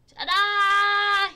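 very loud, high, held-out cry; big storybook fanfare; triumphant reveal. A narrator's reading. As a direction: belt it out like a showman. Groundhog presents himself.

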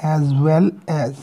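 as well as said very slowly; slow demo phrase. Shown as a demonstration. Teacher sounding out the phrase for students, slowly. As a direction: draw out as well as. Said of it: "इस तरह से लिखा जाएगा".

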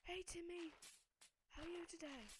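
high, bright greeting voice; a friendly passerby saying hello; playful high-pitched hello; cheery character voice. Pretend.

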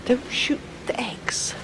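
slow, hushed whisper; whispered warning. Real, not acted. Player creeping past eggs, whispers to himself. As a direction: breathe it out carefully. Sneaking, hushed game moment.

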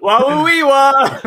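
hammed-up fake Russian babble, high and loud; mock Russian gibberish. Co-host's bad Russian accent to tease the patriot jab. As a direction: clown a bad Borat-style Russian accent. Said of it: "do the rest of the review in bad Russian accent".

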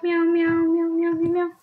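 high, even string of meows; cat meowing. Pretend. Player meowing at a cat player, cat meowing. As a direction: chant meows like a kitty.

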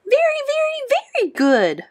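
very high, squeaky hamster voice; happy squeak. A narrator's reading. Hamster boasting about his classmates' care. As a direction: squeak it proudly. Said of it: "I squeaked".

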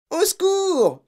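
very high, loud scream; screamed plea. A narrator's reading. Terrified mother clutching her husband, screaming. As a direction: scream for help in panic. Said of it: "hurla la mère".

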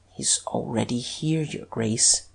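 low, hushed confidential voice; deeply secretive. A narrator's reading. Detective confiding in the Duke, hushed and secretive. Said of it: "lowered his voice and said with an air of the deepest secrecy".

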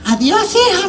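high, sing-song farewell; mock tender goodbye to the daughter; sweet old lady goodbye. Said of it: "me dijo".

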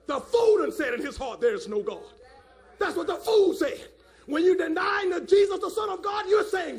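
loud, high bursts of fervent preaching; forceful pulpit shout; full-force preaching. Real, not acted. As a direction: preach it hard, driving the key words up.

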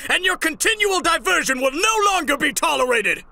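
high, loud, exasperated shouting; furious demand. Acted. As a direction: roar at him to give you answers. Furiously demanding answers from Irwin.

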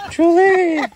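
high, loud call across a field. Real, not acted. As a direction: shout the names to call them over. Calling animals across the yard.

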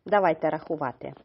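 ordinary delivery

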